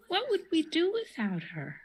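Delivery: usual speaking voice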